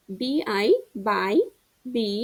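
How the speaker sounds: slow, spelled-out বি আই by, then বি; spelling drill. Shown as a demonstration. Teacher spells for pupils to repeat, slowly. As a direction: read "বি আই by" letter by letter, slowly. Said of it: "আমি যেরকমভাবে বলতেছি".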